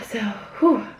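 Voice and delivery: panting, breathless after a long take; out of breath. Real, not acted. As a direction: catch your breath between words. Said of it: "I'm legitimately out of breath".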